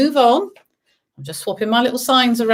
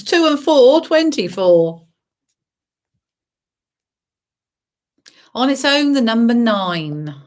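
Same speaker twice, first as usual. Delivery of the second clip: bingo caller; 2 semitones higher than usual. Acted. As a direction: sing out the numbers. Bingo calls.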